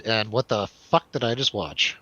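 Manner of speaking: normal talking voice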